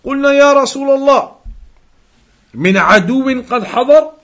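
high, loud and very fast Arabic recitation of the companions' question; urgent recited question. Acted. Teacher recites the companions' question, high, loud and fast.

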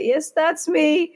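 slow, high, drawn-out playful confirmation; a lightly hammed-up, slow "yes, that's me"; sheepish mock reply. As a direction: stretch it out, high and coy. Awkward reply to guard's joke.

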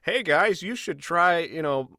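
high, slow salesy pitch voice; a hammed-up podcast ad read; mock ad read. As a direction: sell it like a cheesy sponsor spot. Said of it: "come on my show and go".

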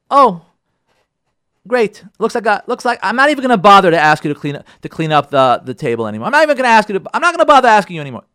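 a fed-up wife giving up on him; sarcastic complaint. Acted. Wife's sarcastic complaint to husband.

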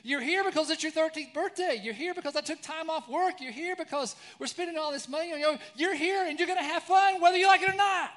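put-on loud, high furious rant at the kids; angry outburst. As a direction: yell it like a dad who has lost it. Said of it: "I lost it".

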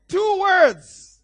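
high, loud preacher's shout; emphatic exclamation. Real, not acted. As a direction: shout it out to drive the point home. Preacher exclaims his point.

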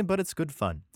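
usual speaking voice

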